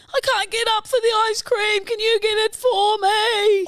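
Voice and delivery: put-on high whiny hurt kid; child voice. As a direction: plead like an injured child. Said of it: "snapped both Achilles tendons".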